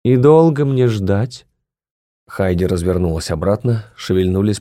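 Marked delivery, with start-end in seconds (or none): 0.0-1.5 impatient demand. Acted.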